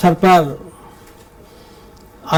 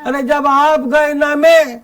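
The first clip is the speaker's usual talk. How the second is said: higher, measured stage verse with ringing line ends; comic poem recitation; 5 semitones higher than usual. Acted. Poet reciting a police satire to a festival audience, declaimed comic verse.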